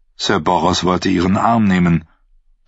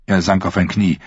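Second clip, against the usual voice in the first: ordinary delivery, nothing marked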